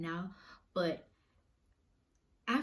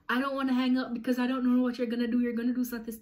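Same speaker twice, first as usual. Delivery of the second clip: fast, higher, insistent mom voice; worried mom imitation; 4 semitones higher, 5 dB louder, faster than usual. Mom refusing to hang up on her child, anxious.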